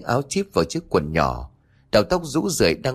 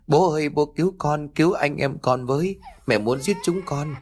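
put-on higher, wailing girl calling for father; shrill crying; 5 semitones higher than usual. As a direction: wail to father through tears. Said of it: "khóc choe choé".

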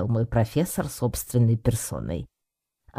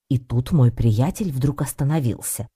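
ordinary delivery

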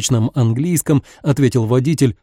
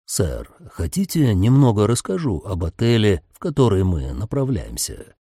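sinister driver voice; 4 semitones lower than usual. A narrator's reading. Driver's voice held.

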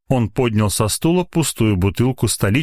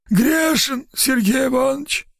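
put-on much higher, whining guilty drunk; plaintive moan. A narrator's reading. Guilty lab assistant pleading with the head doctor. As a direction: moan it out like a caught sinner. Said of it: "жалобно промычал Брыкин".

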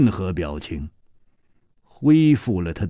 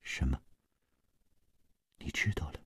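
stunned, breathless near-whisper; shocked hush; 7 semitones lower, 16 dB quieter, faster than usual. Acted. Gong Nanyan whispering in shock at being found out. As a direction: gasp it out, caught off guard. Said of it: "愣了愣，脸色渐渐地变了".

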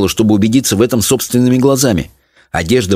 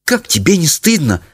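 higher-pitched, scandalised mother; indignant; 8 semitones higher than usual. A narrator's reading. Mother shaming her daughter, indignant. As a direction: scold with raised pitch, indignantly. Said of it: "возмутилась мать".

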